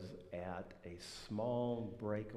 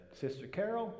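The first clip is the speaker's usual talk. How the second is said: calling out; 9 semitones higher than usual. Retold or imitated. Minister calling wife, raised pitch.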